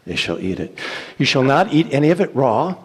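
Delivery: usual speaking voice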